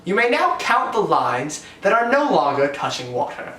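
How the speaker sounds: ordinary talk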